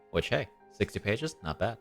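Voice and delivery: ordinary talk, nothing marked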